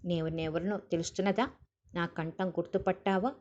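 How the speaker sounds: usual speaking voice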